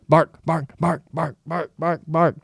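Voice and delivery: high, punched barking words; imitated barking. Dogs at garage door, mimicked insistent barking. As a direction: chant it like a relentless dog. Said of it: "loud barking".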